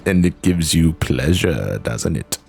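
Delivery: hammed-up slow, menacing character impression; Zenos. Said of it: "I can't believe Xeno voice again".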